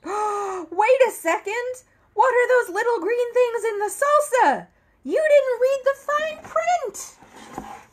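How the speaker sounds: sudden high panicked alarm, loud and urgent. Acted. Narrator panicking to the reader about the salsa, high alarmed cry. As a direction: sound the alarm as if disaster is coming.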